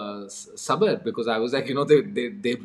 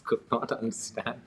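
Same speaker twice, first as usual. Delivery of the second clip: ordinary talk, nothing marked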